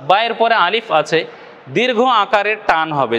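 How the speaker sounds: ordinary delivery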